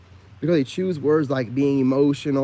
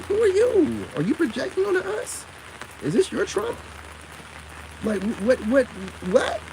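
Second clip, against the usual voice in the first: high incredulous challenge; 10 semitones higher, 3 dB quieter than usual. Real, not acted. Mocking challenge to readers.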